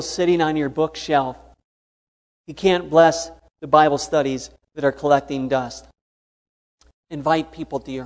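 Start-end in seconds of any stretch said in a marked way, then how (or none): none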